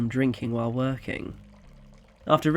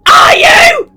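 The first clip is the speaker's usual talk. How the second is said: much higher and louder, furious challenge; scream; 22 dB louder than usual. Acted. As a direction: scream the doubt at him. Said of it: "screamed Georgina".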